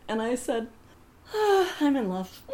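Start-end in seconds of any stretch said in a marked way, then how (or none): 1.3-2.4 high, laughing lovestruck sigh; giddy mock-swooning confession; retold lovestruck swoon.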